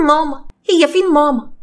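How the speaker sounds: put-on high frightened child wailing for her mother; a small girl waking up crying for mama; crying little girl. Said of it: "صوت صريخ وعياط".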